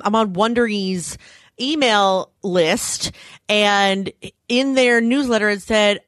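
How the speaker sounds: normal talking voice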